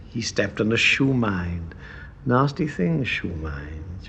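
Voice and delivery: low, soft, sultry radio voice; sultry Sally. Retold or imitated. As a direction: purr it low like a seductive broadcaster. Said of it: "she had the lowest register of any woman".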